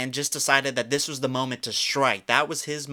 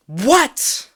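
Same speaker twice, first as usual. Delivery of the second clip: much higher and louder stunned yell; shocked shout; 7 semitones higher, 10 dB louder than usual. Real, not acted. Learning the fan got a tattoo, shocked shouted disbelief. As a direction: explode in disbelief.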